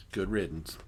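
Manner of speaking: ordinary talk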